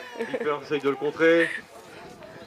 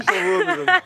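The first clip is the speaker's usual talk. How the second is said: excited exclamation; 13 semitones higher, 5 dB louder than usual. Real, not acted. Commentator overwhelmed by move.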